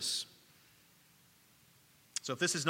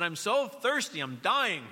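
put-on desperate child; 8 semitones higher, 5 dB louder than usual. Son pleads on baseball field.

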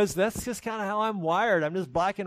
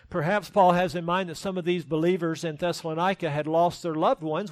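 ordinary delivery

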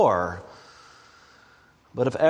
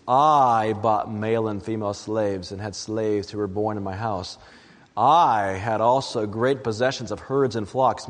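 scripture reading; 4 semitones lower than usual. Acted. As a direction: read Solomon's boast from the pulpit. Reads Ecclesiastes passage aloud.

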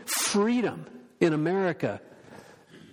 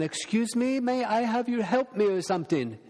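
light imitation of a Chinese accent, higher; a hint of a Chinese accent asking politely; mild Chinese accent, polite request; 7 semitones higher than usual. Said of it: "a very strong accent".

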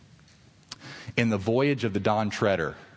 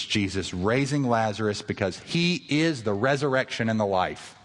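ordinary delivery

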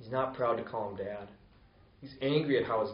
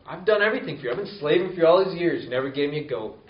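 raised, fast, indignant protest; aggrieved complaint; 4 semitones higher, 6 dB louder, faster than usual. Acted. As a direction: let the resentment spill out at the father. Older son's bitter complaint to father.

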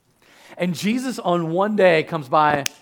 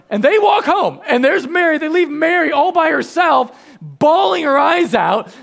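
indignant telling; 10 semitones higher, 8 dB louder than usual. Acted. Mock outrage at the men.

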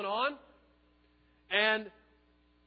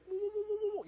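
high mock ghostly "woo" sounds, much higher; spooky hiding noise; 13 semitones higher, 4 dB louder than usual. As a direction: hoot a silly spooky woo like a hidden ghost. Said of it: "you know, hiding himself".

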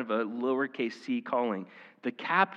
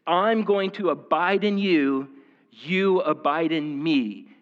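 emphatic command; 4 semitones higher, 6 dB louder, slower than usual. Retold or imitated. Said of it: "his command is".

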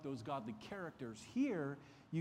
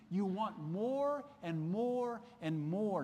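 slow, louder, each more pressed harder; Paul's rebuke; 5 semitones higher, 5 dB louder, slower than usual. Acted. Preacher voicing Paul to the Roman church, slow emphatic rebuke. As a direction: drag out more and more and more.

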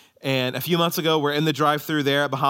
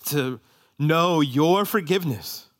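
prayer; 4 semitones lower than usual. Real, not acted. Pastor prays over the congregation.

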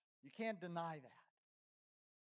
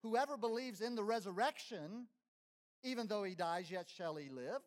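preaching quote; 4 semitones higher, 6 dB louder than usual. Acted. Preacher quoting Jesus emphatically.

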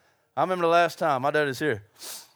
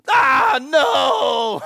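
loud, high anguished no, hammed up; mock protest shout; 5 semitones higher, 8 dB louder than usual. Preacher acting out telling God no, mock shouting. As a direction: yell it like a dramatic refusal.

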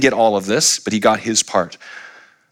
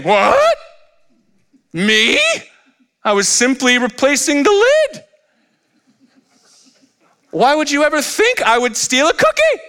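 put-on high, innocent child voice, protesting; caught child. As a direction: play the guilty kid faking innocence. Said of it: "like little children who are caught with their hand".

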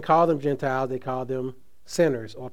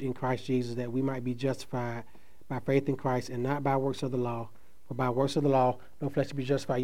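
scripture reading. Acted. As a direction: read the verse out solemnly, letting it fade. Reads the passage again.

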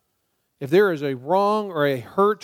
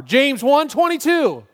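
much higher, louder, slow shout of "James one twenty-two"; car-stereo shout; 9 semitones higher, 6 dB louder, slower than usual. Shown as a demonstration. Pastor shouting the verse reference like driving in his car. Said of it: "Do it like I'm driving down the road in my car".

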